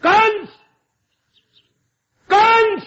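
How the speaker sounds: high, loud commanding cry of the name; the goddess sternly summons the tyrant; stern call. Acted. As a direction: Yogmaya calling Kansa out, loud and high. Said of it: "कैसे वो कड़क के बोली थी".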